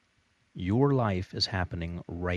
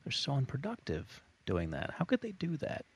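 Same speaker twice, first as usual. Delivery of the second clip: disapproving; 7 semitones higher, 4 dB quieter than usual. Retold or imitated. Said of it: "I used to look at this and think".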